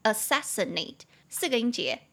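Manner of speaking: ordinary talk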